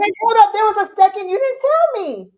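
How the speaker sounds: high, loud protest; indignant surprise. Real, not acted. Co-host learns he missed a second credits scene, indignant surprise. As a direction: burst out in loud, high disbelief.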